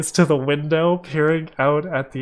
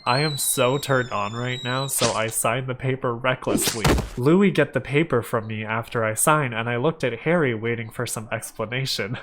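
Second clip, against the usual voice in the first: ordinary delivery, nothing marked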